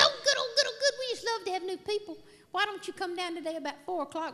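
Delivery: squealed high, loud gushing; bubbly spa girl. Retold or imitated. Spa girl gushing at a new customer, high and loud. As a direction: gush like an overexcited cheerleader.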